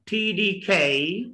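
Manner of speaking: slow T D K as "tee-dee-khay"; drawn-out T D K for copying; say after me. Shown as a demonstration. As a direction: stretch T D K with a puffed K. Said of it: "try to say after me".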